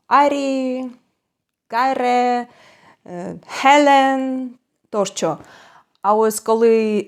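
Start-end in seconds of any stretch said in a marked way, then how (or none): 0.1-5.4 Estonian names said higher, stressing the first syllable. Shown as a demonstration. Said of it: "До прикладу". (естонські імена з наголосом на першому складі)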